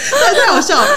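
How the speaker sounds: high burst of real laughter into words; real giggling fit, voice up high; laughing hard. Real, not acted. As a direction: crack up, then squeeze the words out laughing. Laughing fit over the threat.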